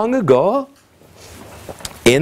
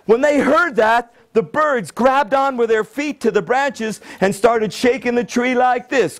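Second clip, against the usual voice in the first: ordinary delivery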